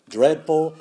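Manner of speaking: usual speaking voice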